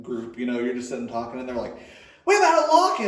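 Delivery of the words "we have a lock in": put-on high, loud excited kid shout; excited announcement.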